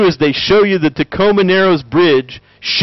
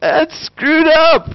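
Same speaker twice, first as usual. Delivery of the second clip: disgusted verdict; 13 semitones higher, much slower than usual. Real, not acted. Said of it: "his own verdict".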